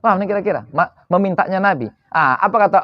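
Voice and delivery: usual speaking voice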